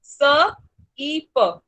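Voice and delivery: स ई प said as separate sounds, स punched out; phonics sounding out. Shown as a demonstration. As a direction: sound out स ई प for a child. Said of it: "वो सिप ही बन रहा है". (स ई प को अलग-अलग आवाज़ों में, स पर ज़ोर देकर बोलें)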